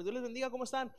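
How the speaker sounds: cheery pastor greeting. Retold or imitated. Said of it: "y el pastor".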